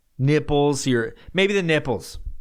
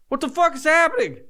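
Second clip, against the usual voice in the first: very high, panicked squeal; high-pitched panicked squeal; 1 freaked out. Pretend. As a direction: squeal it in total disbelief. Panicking at antlers on head.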